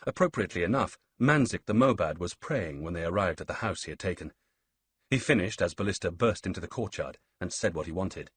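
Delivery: plain reading voice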